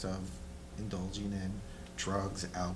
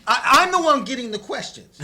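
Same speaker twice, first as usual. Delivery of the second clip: louder and higher, playful outburst; mock protest; 10 semitones higher, 13 dB louder than usual. Guest loudly reminding the host who gets the questions, playful indignation. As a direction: protest loudly that you are the interviewee.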